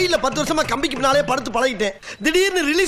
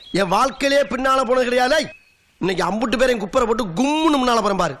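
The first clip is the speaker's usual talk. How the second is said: ordinary talk, nothing marked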